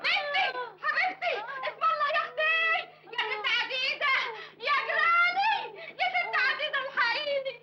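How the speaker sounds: high, frantic lament in one long breath; a high panicked neighbor crying for help; horrified wailing. Acted. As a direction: wail in horror over the hurt girl. Neighbor finds the beaten girl.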